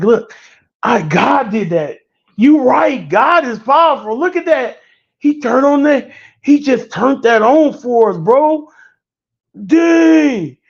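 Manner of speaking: hammed-up sidekick raving that God did it; funny character going crazy. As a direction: lose it like the goofy guy in the movie. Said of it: "he like a little funny character in the movie".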